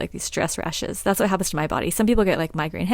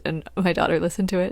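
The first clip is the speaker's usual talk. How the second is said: normal talking voice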